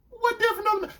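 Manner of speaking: very high defensive mimicry; protesting girlfriend. Girlfriend dodging his question, imitated defensively. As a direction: push back in a high imitated voice.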